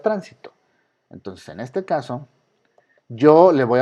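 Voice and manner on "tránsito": tránsito with stress on TRÁN; stress on first syllable. Shown as a demonstration. Teacher repeats the word heard, tránsito, first syllable stressed. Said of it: "escuché la palabra tránsito".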